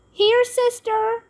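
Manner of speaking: high, slow coaxing call. A narrator's reading. Ivy calls to Kalia with a doll, high coaxing call. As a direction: call sweetly to the toddler. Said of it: "called Ivy".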